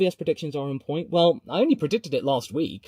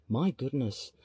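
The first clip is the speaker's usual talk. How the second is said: usual speaking voice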